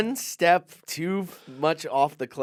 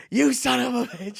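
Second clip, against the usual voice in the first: mock screaming; 9 semitones higher than usual. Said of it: "Rappaport's screaming at him".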